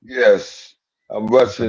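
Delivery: put-on Russian accent. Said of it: "that's your Russian accent".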